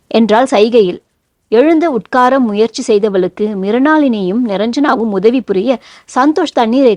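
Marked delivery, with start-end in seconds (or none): none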